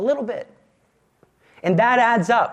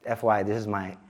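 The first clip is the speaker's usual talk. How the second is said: low, soft mock confession aside; hushed tongue-in-cheek aside; low joking aside; 13 semitones lower, 7 dB quieter, faster than usual. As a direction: drop low and murmur it as a joke. Joking aside about own confession.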